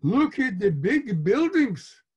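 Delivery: high, loud Yiddish singsong; hammed-up Yiddish immigrant mama; thick pretend Yiddish accent. A narrator's reading. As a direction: exclaim in a put-on Yiddish accent. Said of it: "pretend Yiddish accent".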